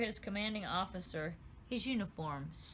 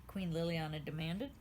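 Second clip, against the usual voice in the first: plain reading voice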